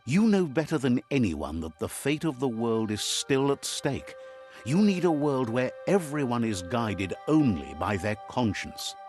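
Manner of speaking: normal talking voice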